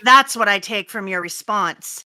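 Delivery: ordinary delivery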